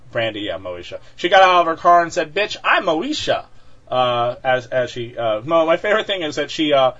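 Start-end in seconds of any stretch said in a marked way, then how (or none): 2.3-3.5 higher, drawn-out mock diva declaration; brash mock outburst. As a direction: announce yourself with mock swagger, slowly. Mocking a driver's brash outburst.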